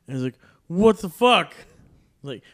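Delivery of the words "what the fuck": high, loud outraged outburst; angry yell. Retold or imitated. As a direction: bark it like a rudely woken drunk. Drunk date suddenly wakes up.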